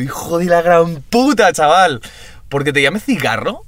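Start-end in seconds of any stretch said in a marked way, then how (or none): 0.0-2.0 angry outburst. Retold or imitated. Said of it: "Y yo".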